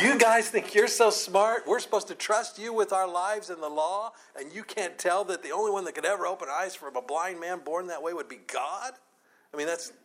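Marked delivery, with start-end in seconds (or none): 0.0-8.9 higher, incredulous challenge with a laugh in it at first; pitched-up sarcastic rant, easing down. Acted. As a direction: challenge them in scornful disbelief. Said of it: "full of these kind of feelings".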